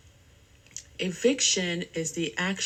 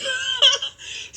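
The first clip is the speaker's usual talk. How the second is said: a real, much higher squealing laugh; high burst of laughter; 6 dB louder than usual. Real, not acted. Laughing at exploded dye bottle.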